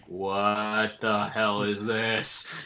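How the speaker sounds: mock-stunned, every word stretched very slowly; drawn-out disbelief. Villain reacting to the hero walking in, slow mock disbelief.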